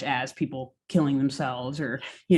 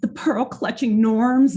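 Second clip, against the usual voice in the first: slow, higher mock-prim tone; mock prudish; 6 semitones higher, 4 dB louder, slower than usual. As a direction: play up the pearl-clutching. Mocking prudish thirties norms.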